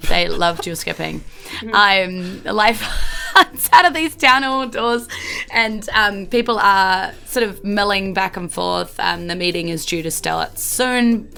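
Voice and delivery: ordinary talk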